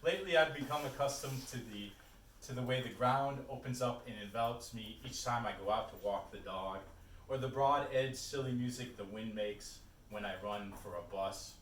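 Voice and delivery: projected recital, each line starting strong and easing down; poetry reading. Acted. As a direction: recite the poem to the room with firm phrasing. Restarts reading the poem.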